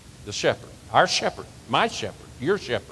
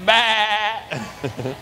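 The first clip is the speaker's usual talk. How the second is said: higher baa like a sheep; sheep bleat; 7 semitones higher than usual. Shown as a demonstration. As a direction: bleat once more. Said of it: "You wanna do it again?".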